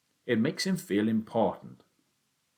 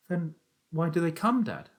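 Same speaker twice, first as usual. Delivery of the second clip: put-on young Danny, higher and curious; boy's voice; 9 semitones higher than usual. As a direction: ask like a puzzled small boy. Boy Danny asks his father.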